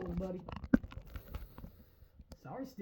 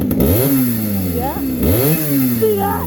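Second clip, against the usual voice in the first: much lower, gruff put-on voice; deep growl; 8 dB louder, much slower than usual. Real, not acted. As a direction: drop the voice way down.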